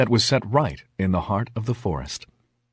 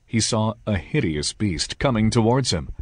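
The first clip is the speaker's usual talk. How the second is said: usual speaking voice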